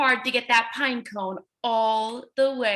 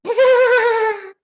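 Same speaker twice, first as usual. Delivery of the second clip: high, loud shivery brrr; shivering brrr; 9 semitones higher, 8 dB louder than usual. Acted. Pine cone shivers in the cold, loud high brrr. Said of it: "It said".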